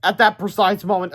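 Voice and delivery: ordinary delivery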